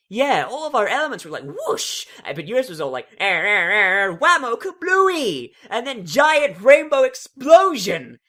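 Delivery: high, loud, bouncy with sound effects; bubbly Pinkie Pie. Acted. Pinkie Pie telling Twilight about the explosion, giddy and loud. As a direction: burst with giddy excitement, drag out the kablooey. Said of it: "says Pinky, complete with sound effects".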